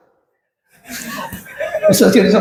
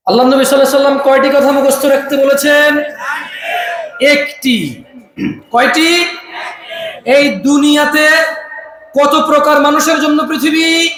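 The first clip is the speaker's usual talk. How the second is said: full-force preaching; 7 semitones higher than usual. Acted. Preacher drilling the gathering in a hadith, full-force preaching. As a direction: preach it out, calling for answers.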